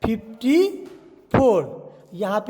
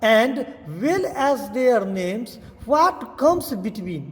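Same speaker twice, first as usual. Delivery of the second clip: slow reading aloud; much slower than usual. Shown as a demonstration. Teacher reads the next task slowly to the class. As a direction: read the instruction word by word, very slowly. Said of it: "next आता है".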